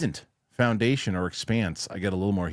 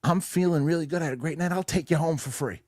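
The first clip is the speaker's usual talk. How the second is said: higher-pitched, sustained mock voice of a guy; put-on higher voice for a quoted stranger; imitated cab driver; 8 semitones higher than usual. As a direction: voice another guy up high, retelling his offer. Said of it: "the guy goes".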